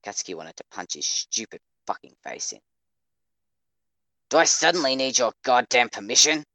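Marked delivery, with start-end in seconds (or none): 4.3-6.5 loud, high furious challenge; angry demand. A narrator's reading. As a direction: demand it angrily of both friends. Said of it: "Katsuki demanded".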